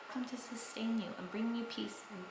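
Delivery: soft, breathy whisper of comfort. Real, not acted. Vlogger blesses viewers going through hardship, whispered. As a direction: hush it like a blessing.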